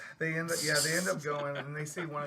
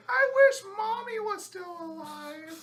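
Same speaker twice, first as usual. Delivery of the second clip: very high, loud, drawn-out cry; hammed-up childish yelling; mock child's wail; 7 dB louder, slower than usual. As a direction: wail it like a kid in a movie. Acted child's wail in retelling.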